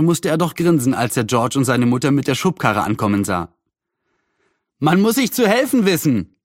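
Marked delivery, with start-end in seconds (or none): 4.8-6.4 high-pitched cheery call; lightly acted boy's shout, pitched up. A narrator's reading. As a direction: call it across to them. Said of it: "rief Julian".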